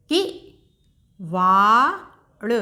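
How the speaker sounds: किवाड़ stretched very slowly, falling at the end; slow teacher blending; slow sounding out. Shown as a demonstration. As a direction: draw out किवाड़ for a learner. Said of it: "एक बार फिर से दोहराते हैं".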